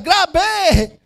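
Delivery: high, drawn-out answering call; echoed hype cry. Acted. Passenger answering a stranger's slogan in a jeepney, hype cry. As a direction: answer the slogan with fanatical zeal. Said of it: "Sabi niya".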